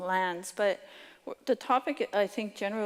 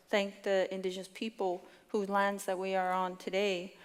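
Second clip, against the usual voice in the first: ordinary talk, nothing marked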